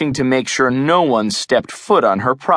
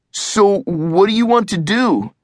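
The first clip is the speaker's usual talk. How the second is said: cringing; 8 semitones higher than usual. A narrator's reading.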